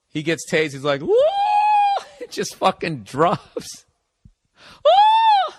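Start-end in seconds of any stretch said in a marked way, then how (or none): 1.0-2.2 imitating a tased cop's soaring operatic woo; a mock opera-finale whoop, pitched way up; operatic high whoop. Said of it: "sounds like he's, like, ending an opera". 4.8-5.5 a much higher, louder mock opera-singer woo; operatic whoop. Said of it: "He does literally something like that".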